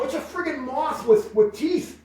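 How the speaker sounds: high mocking scoff at the bat. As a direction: brush off his fear with a high jeer. Teasing him about the bat.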